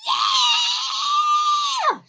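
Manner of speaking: long, very high shriek; screamed. A narrator's reading. Millie seeing her packages fallen, long scream. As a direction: let out a drawn-out scream. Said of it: "she screamed Millie".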